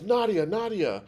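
high, loud repeated call. Retold or imitated. Man in the press conference audience calls out to her, loud and high. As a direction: yell the name twice to be noticed.

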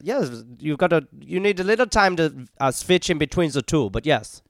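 put-on German accent; demonstrating a German accent on request. Shown as a demonstration. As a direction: switch into a mock German accent. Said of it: "can you do a German?".

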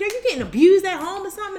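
retold alarmed questioning. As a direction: blurt the worried question, high and quick. Retells Mister Watson questioning her crying.

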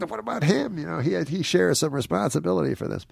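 high, indignant plea; retold protest. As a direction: plead your case over the hecklers. Retold plea to yelling crowd.

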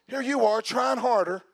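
ordinary talk

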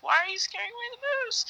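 high, quick, plaintive mock complaint; high-pitched retold protest; acted-out protest. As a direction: squeal the question in mock dismay. Acts out protest at truck.

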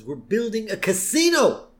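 high mock outburst, rising on the last word; Mr. Burns. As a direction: protest as an impatient old tycoon. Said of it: "And Burns says".

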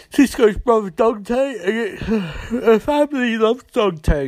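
high voice breaking into laughter; trying to get it out through laughs; laughing. Real, not acted. Podcaster introduces Cisco's brother Dante, laughing. Recapper cracks up at brother's name.

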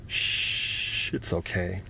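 soft whispered shushing; mother rocking a crying child, soft whisper; soothing hush. Acted. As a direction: hush him tenderly. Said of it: "she rocked him".

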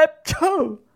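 loud, high "ecciù" sneeze; big acted sneeze. A narrator's reading. Mangiafuoco starting to sneeze, loud high mock sneeze. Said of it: "si mise a starnutire".

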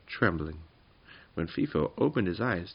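ordinary delivery

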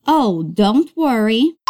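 ordinary delivery

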